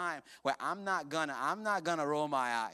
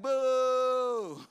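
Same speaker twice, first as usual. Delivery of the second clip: loud, high booing crowd; mock boo; 6 semitones higher, 6 dB louder than usual. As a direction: boo them like a disappointed crowd.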